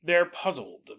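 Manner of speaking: ordinary delivery, nothing marked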